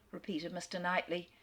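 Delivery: ordinary delivery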